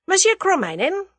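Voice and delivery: loud, high-pitched abrupt address. A narrator's reading. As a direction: call out the name abruptly. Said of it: "said the latter abruptly".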